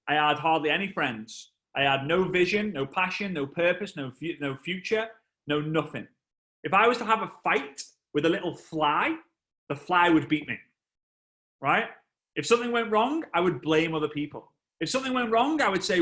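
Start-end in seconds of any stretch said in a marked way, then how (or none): none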